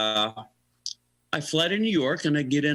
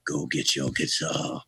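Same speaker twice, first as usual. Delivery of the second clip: low, slow, tipsy rocker impression; 10 semitones lower, 3 dB quieter, slower than usual. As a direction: repeat the order slowly, voice dropped deep. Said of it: "he looked like he had a few".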